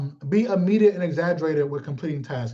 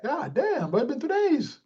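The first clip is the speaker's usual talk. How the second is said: high-pitched exasperated complaint; mock disgust; 8 semitones higher, 3 dB quieter than usual. Griping at a roommate who never showers, mock disgust. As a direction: act appalled at the roommate.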